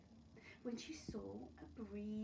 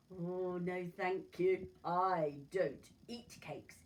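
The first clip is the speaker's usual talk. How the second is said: wolf voice; 8 dB louder, slower than usual. Acted. Wolf declines cakes.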